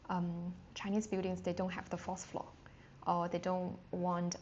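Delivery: usual speaking voice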